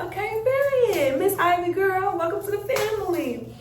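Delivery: high-pitched, sing-song cheer; hyped, lilting greeting; excited welcome. Retold or imitated. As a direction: gush a playful welcome to a new pet. Acting out welcoming new dog excitedly.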